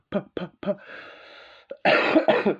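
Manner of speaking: slow, breathy lip pops without voice; lip-popping puffs. Shown as a demonstration. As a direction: pop your lips slowly to show how they work. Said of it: "I'm using my lips now".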